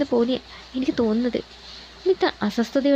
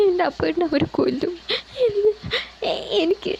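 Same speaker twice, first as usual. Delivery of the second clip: voice breaking; 7 semitones higher than usual. A narrator's reading. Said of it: "അവളുടെ ശബ്ദം കുഴഞ്ഞു".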